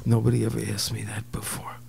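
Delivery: breathy whisper; whispered confession. Retold or imitated. Young man whispers his confusion to Walter. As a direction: whisper it, bewildered. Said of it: "Uh, I, I don't know".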